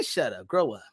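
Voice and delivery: ordinary delivery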